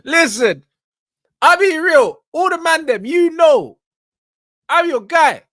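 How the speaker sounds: bursting in loud to back the mandem; hyped shout. Real, not acted. Laughing at the clip, calling out to the boys loudly.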